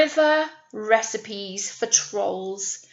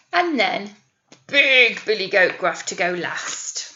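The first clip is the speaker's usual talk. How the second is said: lively storybook reading voice; animated picture-book narrator; story narration; 8 dB louder, slower than usual. Acted. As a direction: read it out to children with energy.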